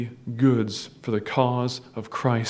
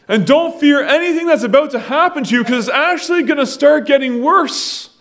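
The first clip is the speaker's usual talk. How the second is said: high, loud impassioned pulpit voice; full-force preaching; 9 semitones higher, 4 dB louder than usual. Acted. Pastor urging the church not to fear, impassioned preaching. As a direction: drive the warning home to the flock.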